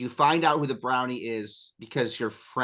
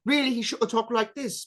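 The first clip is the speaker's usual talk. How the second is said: a quick, light British accent, pitched higher; 10 semitones higher than usual. Shown as a demonstration. Game master showing how the brownie should really sound, light British accent. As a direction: toss off a brief mock British accent. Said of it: "brownies are actually British".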